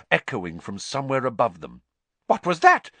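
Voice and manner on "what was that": high, loud startled question; alarmed. A narrator's reading. Madame Pumphrey hears a distant roar, alarmed. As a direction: cry out at a sudden noise. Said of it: "said Madame Pumphrey in alarm".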